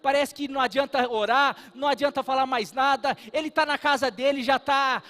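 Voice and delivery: full-force preaching. Acted. Pastor preaching hard to the congregation about hurting loved ones, loud and fervent. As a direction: drive the point home from the pulpit.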